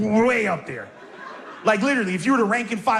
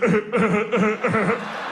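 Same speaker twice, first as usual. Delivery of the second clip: imitated stifled snicker. George watching his prank land, imitated snickering. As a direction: mimic a friend snickering in little bursts.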